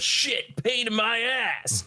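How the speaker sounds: high, exasperated outburst; pissed off. Retold or imitated. Bully McGuire as the armor re-forms, pissed off. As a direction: blurt it high like an irritated villain. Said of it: "which pisses off Bully McGuire".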